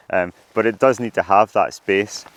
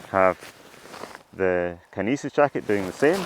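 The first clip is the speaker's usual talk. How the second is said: muffled. Real, not acted. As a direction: talk muffled through fabric over your mouth. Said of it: "I'm going to sound muffled here".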